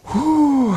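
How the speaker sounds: a sharp high huff of breath, blown out hard. Shown as a demonstration. Preacher demonstrating wrath for the congregation with a high, forceful huff. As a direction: blow out a heavy, fierce breath like you're fuming. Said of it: "this is like that of breathing hard".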